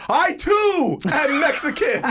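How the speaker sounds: high, slow, loud proclamation through laughter; laughing scream. Retold or imitated. Revealing his own heritage in the café fight, laughing shout. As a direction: announce it like a grand reveal, half laughing. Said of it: "And I just screamed".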